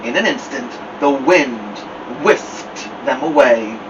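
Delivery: ordinary delivery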